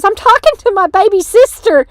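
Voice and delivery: high, loud mock declaration; imitated loud announcement. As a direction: declare it across the room. Sister loudly informing stranger, retold.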